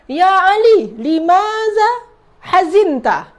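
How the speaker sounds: calling to Ali. Acted. Teacher demonstrates asking sad Ali, called out high and slow. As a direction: call out his name, then stretch the question. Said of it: "Atau kita tanya".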